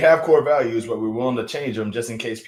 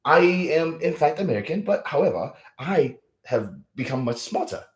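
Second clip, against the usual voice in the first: much higher, prissy upper-class British voice; mock posh British accent; 6 semitones higher than usual. Mocking how Americans hear a British accent, high posh British voice. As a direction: play a pompous English toff. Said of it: "the most British voice imaginable".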